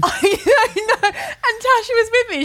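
talking through high laughter; can't stop giggling while telling it; laughing. Real, not acted.

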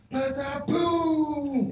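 a high, punchy imitated slap sound; mock smack noise. Telling friends about grabbing the cyclist, a mock smack noise. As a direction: pop it out like a sudden smack.

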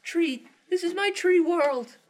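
very high, squeaky cartoon voice, laughing through it; shrill-pitched angry little cartoon guy guarding his tree; tree guy impression. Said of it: "that impression of the, the tree guy from that, from Accounting".

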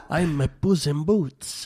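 slow put-on Puss in Boots voice; a lightly voiced Antonio Banderas cat; Puss in Boots impression. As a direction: purr it out as the suave cat. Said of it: "first time I've ever done that impression".

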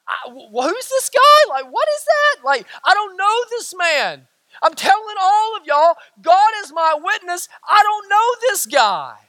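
high-pitched, incredulous protest; ramped-up denial. Acted. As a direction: escalate into insistent, hammed-up disbelief. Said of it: "beginning to, to ramp that up".

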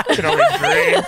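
high, laughing repeat of the line; laughing mock echo. Repeating friend's line mockingly.

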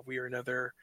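normal talking voice

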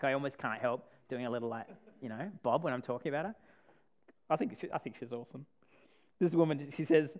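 usual speaking voice

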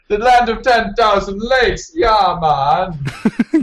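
exaggerated Jamaican accent, high and loud. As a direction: imitate a hammy Jamaican accent. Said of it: "Did you just talk about Minnesota in a Jamaican accent".

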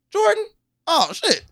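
very high, excited greeting with a laugh; surprised recognition. Retold or imitated. Masked robber recognizes the teller.

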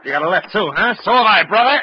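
loud, high fighting taunt; tough shout. Acted. Marlowe shouting back mid fistfight. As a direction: taunt him as you punch back.

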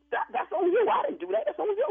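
high-pitched, insistent denial; defensive protest. Real, not acted. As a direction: push the blame back, voice jumping up. Disclaims blame in heated dispute.

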